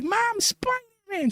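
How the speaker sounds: high, drawn-out squeal; imitated excited kid. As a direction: mimic a child calling Mom in delight. Excited kid calls to his mom.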